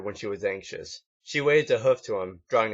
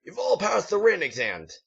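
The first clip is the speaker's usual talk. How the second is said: higher, pompous drill sergeant voice; gruff drill instructor; 10 semitones higher than usual. A narrator's reading. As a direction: address the recruits like a proud sergeant. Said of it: "The instructor continued".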